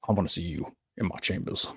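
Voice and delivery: lightly voiced, low judge; quiet, lightly played courtroom summons. Judge summoning a lawyer to chambers, lightly voiced and quiet. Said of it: "he's like".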